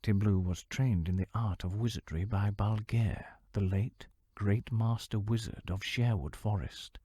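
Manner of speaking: ordinary delivery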